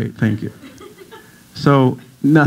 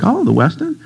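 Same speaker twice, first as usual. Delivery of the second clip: impressed question; 10 semitones higher than usual. Retold or imitated. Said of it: "They're like".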